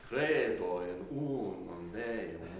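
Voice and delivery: "Credo in unum Deum" slow, accented vowels drawn out; stretched Latin accent. Shown as a demonstration. Teacher showing the stretched sense, slow drawn-out accents. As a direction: lengthen "Credo" and "unum" lightly, without pressure. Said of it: "that sense of high, light, stretched".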